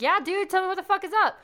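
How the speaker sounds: put-on high, quick hype-up of a kid; match a kid's excitement like a pal; hyped-up buddy talk. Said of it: "that's how I talk to kids".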